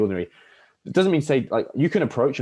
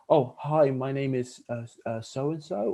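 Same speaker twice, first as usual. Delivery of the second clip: nervous, unsure tone; 6 semitones higher, slower than usual. Shown as a demonstration. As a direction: sound timid and unsure of your own name. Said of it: "it's like the tonality".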